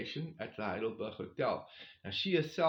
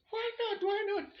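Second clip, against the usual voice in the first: much higher excited squeak; giddy why not. Retold or imitated. Hiker caves to burger and milkshake, giddy high why not. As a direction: give in with a giddy yelp. Giving in to temptation.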